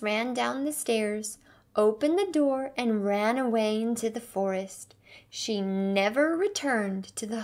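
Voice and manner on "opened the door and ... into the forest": usual speaking voice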